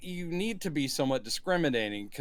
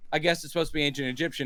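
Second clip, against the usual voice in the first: normal talking voice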